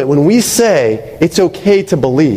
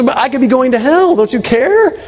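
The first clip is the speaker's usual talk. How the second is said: upset Elaine, mock pleading; 9 semitones higher than usual. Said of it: "she gets mad at him".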